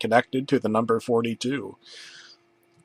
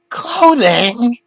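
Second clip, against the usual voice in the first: high louder scream; 12 semitones higher, 7 dB louder than usual. Acted. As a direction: shriek the word. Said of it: "Arthur screamed".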